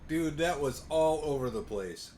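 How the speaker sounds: normal talking voice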